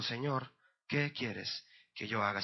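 low, soft, slow question; humbled surrender. Acted. Humbled Paul asks Jesus what to do, quiet surrender. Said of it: "porque ya estaba humillado".

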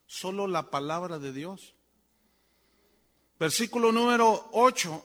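ordinary delivery

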